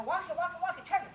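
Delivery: quick, urging "walk it, walk it, target"; upbeat, forward cue; driving voice. Shown as a demonstration. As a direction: keep pushing the dog on. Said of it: "the tone of voice feels like I'm driving him forward".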